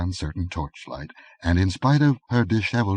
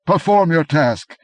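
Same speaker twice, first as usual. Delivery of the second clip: slow, higher, louder order; slow furious command; angry command; 10 semitones higher, 8 dB louder than usual. A narrator's reading. Aquilonian ordering the headsman, slow angry command. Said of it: "he exclaimed angrily".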